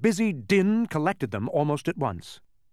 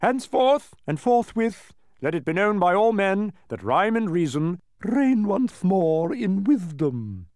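higher, declaiming herald, slowing at the close; formal proclamation; 5 semitones higher than usual. A narrator's reading. Cabinet members read from an enormous scroll, formal proclamation. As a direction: read out a royal decree. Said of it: "they stated in order that".

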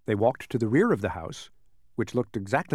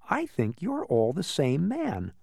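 slow, higher-pitched, every word weighted in "I think you're all the same man"; emphatic; 5 semitones higher, slower than usual. A narrator's reading. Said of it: "said Milo emphatically".

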